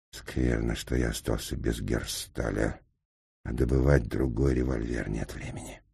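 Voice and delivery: low, hushed, worried mutter; muttering under breath. A narrator's reading. Engineer frets over his lost pistol in a low mutter. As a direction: think aloud in an undertone. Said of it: "пробормотал вполголоса".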